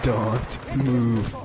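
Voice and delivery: deep, measured captain impression; Captain McMillan. Player mimics captain holding his man back, low impression. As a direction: order it low and steady. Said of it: "Captain McMillan voice".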